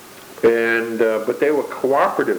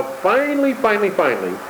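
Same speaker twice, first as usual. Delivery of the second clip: emphatic finally; 10 semitones higher than usual. Real, not acted. Announcing the last topic.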